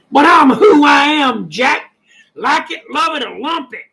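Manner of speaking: suddenly shouting with country pride; defiant shout. Real, not acted. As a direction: belt it out, unapologetic. Defiant return to own country accent.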